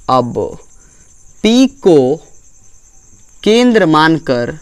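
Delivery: slow, spaced dictation for note-taking. Shown as a demonstration. Teacher dictating notes to the class, slow and spaced. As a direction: dictate word by word so pupils can write.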